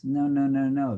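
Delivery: ordinary delivery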